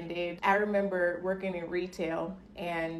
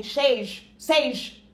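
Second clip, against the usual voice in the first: louder, higher call of a name; 5 semitones higher, 7 dB louder than usual. Retold or imitated. Customers from Brazil calling her name, louder higher call. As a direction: shout a name across a shop. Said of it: "shout out to me".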